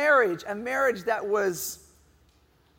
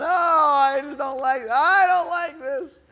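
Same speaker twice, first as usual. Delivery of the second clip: loud, high hammed-up wail; mock crying yell; 6 semitones higher, 7 dB louder, slower than usual. Sick dad yelling at his family, mock crying wail. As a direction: wail like a big baby. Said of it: "I'm crying and yelling".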